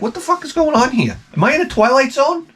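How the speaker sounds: high-pitched disbelief; incredulous. Retold or imitated. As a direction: squeal it in disbelief. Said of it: "I'm like".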